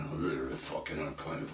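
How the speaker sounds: a grumbling gargoyle put-on; the Scottish press grumbling like gargoyles; mock growl. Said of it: "gargoyles on the right-hand side growling".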